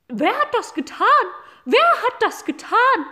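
high, loud honking goose voice; gossiping geese. Acted. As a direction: honk the question twice, rising in alarm.

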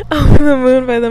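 laughing through the words; can't stop giggling. Real, not acted. To listeners, laughing through a song title.

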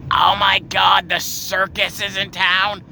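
high, loud, drawn-out gushing; mocking impression of a loud circus fan; loud excitable woman. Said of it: "That's a fucking bang on impression".

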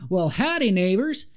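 high, folksy Appalachian howdy; Appalachian accent. Acted. Mothman greets the couple like a roadside hitchhiker, Appalachian accent. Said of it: "in that classic Appalachian accent".

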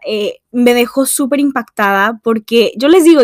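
usual speaking voice

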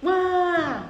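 a high burst of imitated crying; mock wail. Crying in the closet during therapy, mock wail. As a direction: let out a cartoonish sob. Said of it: "And I was like".

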